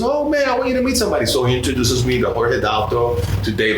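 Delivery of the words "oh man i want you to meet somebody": excited buddy. Retold or imitated. Sal voiced in story.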